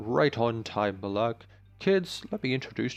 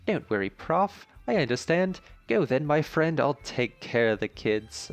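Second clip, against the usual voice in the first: put-on dojo master in a bright, higher British accent; 4 semitones higher, 3 dB louder than usual. British dojo master reassuring the professor, British accent. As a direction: play a friendly British mentor. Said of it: "but that's her accent".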